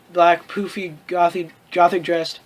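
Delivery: normal talking voice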